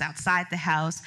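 ordinary talk

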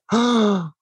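sudden shocked intake of breath; sharp gasp. Retold or imitated. Retelling the border slip, a sudden gasp. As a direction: gasp as the mistake sinks in. Realizing the visa mistake.

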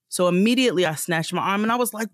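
usual speaking voice

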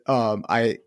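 ordinary talk

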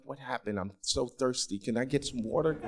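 just waking from surgery, murmur feebly; faint, thirsty plea; groggy patient. Retold or imitated.

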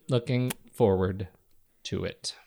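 slow and deliberate, sinking away at the end; slow, low repeat. Real, not acted. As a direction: draw it out, pause before "to it".

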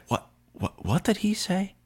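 high, hushed mock bewilderment; Golic, baffled. Host imitating Golic's reaction to the heckler, high soft bafflement. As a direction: imitate a man puzzled by what he just heard.

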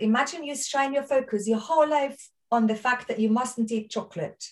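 ordinary talk

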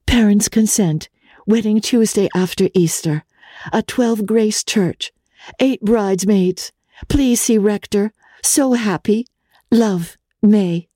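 a hint of May's breathless joy; young fiancée's telegram. A narrator's reading. May telegraphs her news to her cousin, lightly happy.

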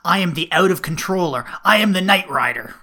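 loud, ranting movie boast; booming, mounting self-proclamation; Knight Rider. Acted. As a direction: declaim it like a crazed outlaw. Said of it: "From The Knight Rider, Mad Max".

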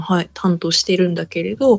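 whispered aside. Real, not acted. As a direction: drop to a whisper. Unvoiced aside.

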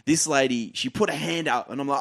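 normal talking voice

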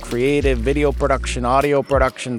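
put-on outrageous Great Lakes accent ad read; hammed-up Great Lakes accent, sponsor spot. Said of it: "I'm using this outrageous Great Lakes accent".

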